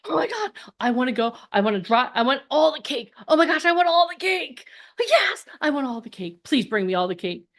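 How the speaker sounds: giddy, rising to a high squeal of want; emotional brain. Acted. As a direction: blurt it out like an overexcited craving. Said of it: "the emotional part of my brain".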